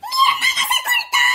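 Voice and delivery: very high, hammed-up cry for help; panicked scream. Boy yells for help at school, panicked scream. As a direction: scream in mock panic for your sister.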